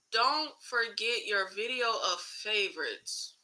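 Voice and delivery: slow, soft read-aloud with a falling end; slow puzzled rereading. Real, not acted. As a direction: go through "Don't forget your video of favorites" word by word, quietly. Rereads the puzzling comment slowly.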